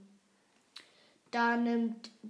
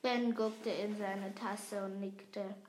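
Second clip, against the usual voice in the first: plain reading voice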